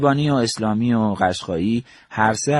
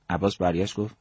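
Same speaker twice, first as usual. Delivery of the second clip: plain reading voice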